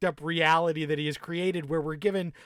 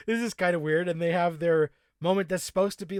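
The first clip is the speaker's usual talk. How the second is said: usual speaking voice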